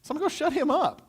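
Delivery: put-on a kid itching for the fight; eager young David. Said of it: "And David's going".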